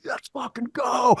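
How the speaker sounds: high, slow yell of triumph; the team storming into the dugout, shouting in high triumph; celebrating shout. Retold or imitated. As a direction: yell it like the team after the strikeout. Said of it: "We're like".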